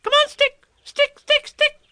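put-on very high, squealing child's call; excited little creature cheering. A narrator's reading. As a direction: urge it on in a tiny high voice. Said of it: "calling out".

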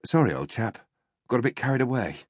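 softly spoken plummy English, winded after the beating; out of breath. A narrator's reading. Boyd apologises to Early after kicking him, out of breath. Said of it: "He was out of breath".

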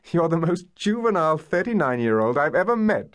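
high, helplessly amused exclamation; gasped amusement. A narrator's reading. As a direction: gasp it out in mock reproach. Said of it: "she gasped".

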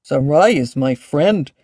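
slow dignified declaration; mildly proud dragon voice. A narrator's reading. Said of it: "Ashley declared with some dignity".

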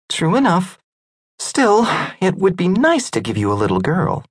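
high, wistful woman's voice; Greya's voice. Acted. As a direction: wish aloud for a little girl. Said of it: "Greya sighed".

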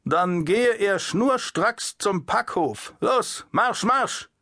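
high, slow, then clipped marching order; barked guard order. Acted. The guard commands the puppeteer to march to the warehouse. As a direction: order him off like a drill guard.

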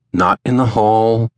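usual speaking voice

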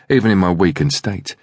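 ordinary delivery, nothing marked

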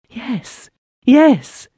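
high, urgent double yes; eager exclamation. A narrator's reading. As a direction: burst out with it. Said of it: "she exclaimed".

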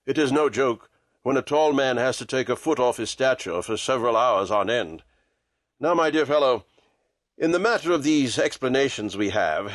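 low, measured detective; Holmes, businesslike. A narrator's reading. Holmes asks Watson's help in a night's work, low and businesslike. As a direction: lay out the plan in a composed low voice.

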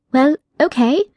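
put-on a polite girl demanding her cat back; firm yet polite young heroine; young Alice. Alice character voice.

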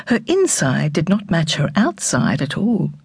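usual speaking voice

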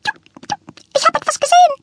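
very high, fast, breathless chirp; chirping bird. A narrator's reading. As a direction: zip through it like a tiny bird. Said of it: "zirpte der Grünling weiter".

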